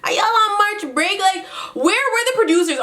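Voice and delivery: high-pitched, incredulous question; exasperated disbelief. Retold or imitated. Asking where the producers went, high and exasperated. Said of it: "It's like".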